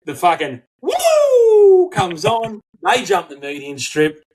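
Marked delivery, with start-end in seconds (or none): 0.0-2.6 high, slow wailing siren sound; mock siren wail; siren imitation. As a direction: stretch the woo out like a police siren. Imitating police siren in story.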